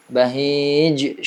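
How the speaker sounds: ordinary delivery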